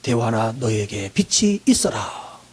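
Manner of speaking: very low, very slow, quiet divine pronouncement; God's command. Acted. Preacher voicing God speaking to his people, deep and slow. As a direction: stretch out 빛이 deep and slow like a voice from heaven. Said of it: "하나님이 말씀합니다".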